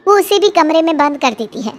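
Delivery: ordinary delivery, nothing marked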